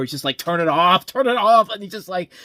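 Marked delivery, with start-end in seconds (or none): 0.4-1.7 anguished mock shout, rising higher on the repeat; George C Scott. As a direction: yell it like a man losing his mind. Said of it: "he's like George C Scott in Hardcore".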